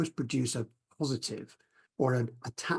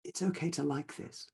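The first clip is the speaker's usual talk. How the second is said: softer, gentle inner permission; said inwardly; 7 dB quieter than usual. Shown as a demonstration. Teacher showing students the phrase to say inwardly, softer. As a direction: say it softly to yourself, as if inward. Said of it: "we can actually say inwardly".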